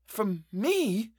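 breathless, shocked question; panting. A narrator's reading. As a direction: gasp it out. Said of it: "I panted".

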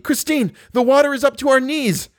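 high, urgent shout; crying out. A narrator's reading. Chagny warns Christine of rising water, high cry. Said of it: "cried Monsieur de Chagny".

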